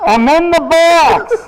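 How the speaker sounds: slow, high call from inside a box. Retold or imitated. Man in the Goodwill box hollers back, slow and high. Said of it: "And he goes".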